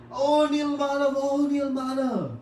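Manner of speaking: joyful cry. Retold or imitated. Starved devotees dance and hail Nilmadhav, ecstatic high cry. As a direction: cry out in joy, stretched slow.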